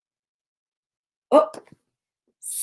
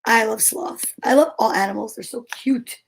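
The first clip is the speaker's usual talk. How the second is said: ordinary delivery, nothing marked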